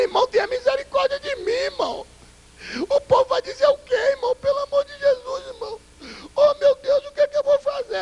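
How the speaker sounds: whiny pleading presbyter. Retold or imitated. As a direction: imitate a grown man whimpering and begging. Presbyter begs pastor, voiced in story.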